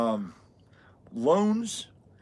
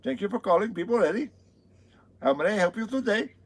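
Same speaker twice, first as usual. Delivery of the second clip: high, bright customer service voice; perky phone agent greeting; call center voice; 7 semitones higher, 4 dB louder than usual. Pretend.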